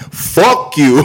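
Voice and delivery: loud playful fuck you; mock diss. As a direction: fling it at the haters.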